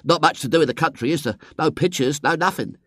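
put-on high, quick boy's chatter; country-disparaging boy. A narrator's reading. Boy finding the country dull, high chatter. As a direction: complain lightly in a high boy's voice. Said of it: "he said in a mollifying tone of friendship".

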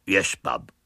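put-on low, dull slum-boy reply; lightly throaty boy. A narrator's reading. William in disguise answering Miss Milton, dull and low. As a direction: mumble like a slow lad.

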